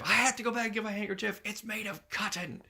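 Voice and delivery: high, melodramatic wail of a quote; mock-dramatic lament. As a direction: plead it in a put-on high voice. Voicing Gene's dilemma over handkerchief.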